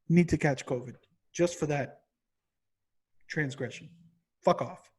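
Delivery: normal talking voice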